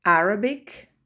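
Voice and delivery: Arabic loud, stress on AR. Shown as a demonstration. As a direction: hit AR first in Arabic. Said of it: "Les exceptions". (accent tonique sur AR dans Arabic)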